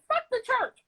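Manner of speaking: high-pitched, hammed-up dismissal; mock defiance. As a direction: play a dramatic walkout for laughs. Acts a dismissal she didn't make.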